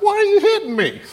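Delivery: very high squeaky donkey voice, laughing through it; donkey whining at Balaam with a laugh; whiny donkey. Acted. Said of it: "She's like".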